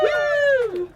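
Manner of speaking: excited cheer. Real, not acted. Cheering after captain's introduction.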